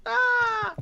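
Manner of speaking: high startled yelp; jump-scare shriek; mock scream. Mock scare after the spider pitch.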